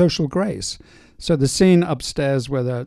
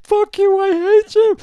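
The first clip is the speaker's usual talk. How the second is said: very high, loud wailing imitation; mock hysterical tantrum; 8 dB louder than usual. Director imitates actress melting down before action, hammed-up tantrum. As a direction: screech it fast, hammed-up actress meltdown. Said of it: "She was gonna throw herself off".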